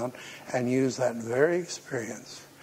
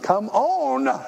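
desperate holler to God; 11 semitones higher, 5 dB louder than usual. Retold or imitated. Preacher retelling hollering at God, loud pleading shout. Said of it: "And I hollered to God like".

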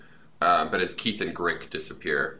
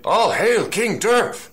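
high proclamation; 13 semitones higher, 6 dB louder, slower than usual. Acted. Crowd proclaiming new king.

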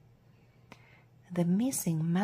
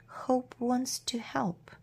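ordinary delivery, nothing marked

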